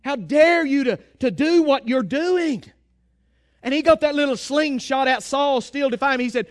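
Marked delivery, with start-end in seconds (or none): 0.0-2.6 slow, emphatic righteous outrage; acted-out indignant challenge, words drawn out. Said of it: "David became indignant".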